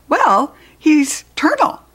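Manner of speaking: high, slow little pupil voice; child answering in class. A narrator's reading. Pupil Heidi answers the teacher's question, high child voice. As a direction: pipe up like an eager schoolchild.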